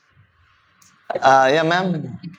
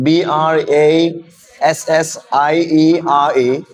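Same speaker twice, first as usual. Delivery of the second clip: ordinary talk, nothing marked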